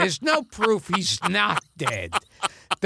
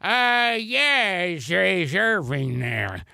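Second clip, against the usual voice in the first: Bob Hope; slower than usual. Acted. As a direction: mumble it as an aged comic calling late. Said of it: "And it goes".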